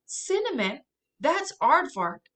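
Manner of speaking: put-on boy calling out across the garden. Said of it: "Jonah calls out".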